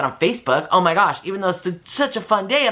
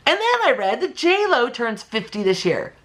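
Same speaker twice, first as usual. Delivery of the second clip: usual speaking voice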